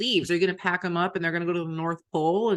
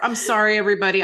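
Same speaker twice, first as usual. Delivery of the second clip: ordinary talk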